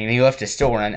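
ordinary talk, nothing marked

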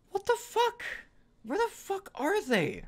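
high strained complaint; frustrated high cry. Real, not acted. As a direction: whine it out in disbelief. Searching frantically.

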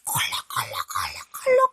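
breathy whispered cough sounds. Acted. Parents cough in story. (속삭이는 기침 소리)

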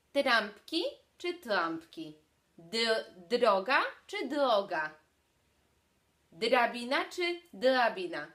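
trampki first with a proper R, then with a faulty R, slowly; good versus defective R. Shown as a demonstration. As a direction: contrast the correct and the defective R, slowly. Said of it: "w kontraście z wadą wymowy".

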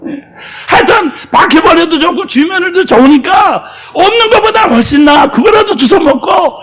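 loud, high fervent sermon shouting; loud pulpit preaching, climbing to shouts; full-force preaching. Acted. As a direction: preach it out to the congregation with fire.

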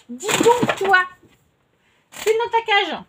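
high, sing-song telling-off of a pet; mock-stern scolding of a naughty bunny; scolding the rabbit. Real, not acted. To her rabbit Nesquik, misbehaving: high mock-stern scolding.

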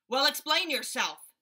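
loud, demanding gorilla; gruff gorilla. A narrator's reading. Gorilla demanding answers from the father, loud. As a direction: bellow it like an impatient ape. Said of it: "said the gorilla".

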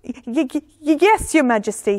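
stammering, timid advisor; nervous mumble. A narrator's reading. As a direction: stumble over the first word, meekly. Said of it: "his advisors mumbled".

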